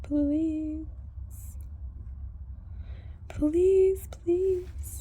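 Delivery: high begging voice for the horse; high, pleading pet voice; voicing the begging horse. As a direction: plead like a hungry mare, high. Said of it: "She's like".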